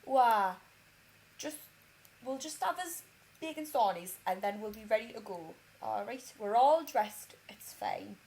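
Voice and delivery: laid-back Geordie impression; Geordie accent. Partner calming mum over bacon sarnies, relaxed mock Geordie. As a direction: imitate a calm, unhurried Geordie. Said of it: "he's a Geordie, but he's got more of a Durham accent".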